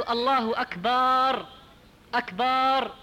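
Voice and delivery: أكبر slow, ending in a long held "baar"; long alif after the ب of أكبر. Shown as a demonstration. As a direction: stretch أكبر into akbaaar. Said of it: "إدخال ألف بعد الباء".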